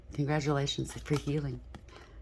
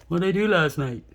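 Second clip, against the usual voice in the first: louder, changed voice of someone waking up groggy; mock hungover partner; 3 semitones higher, 8 dB louder than usual. Reader mimics the partner waking up after drinking, loud mock voice. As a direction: ham up the regretful morning-after question.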